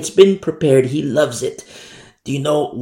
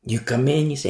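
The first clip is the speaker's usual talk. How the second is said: ordinary talk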